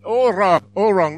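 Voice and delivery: loud, badly faked Russian; failed Russian accent. Comic retrying the Russian's answer, badly faked Russian accent. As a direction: overdo an accent from the wrong country. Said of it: "this accent is from some other country".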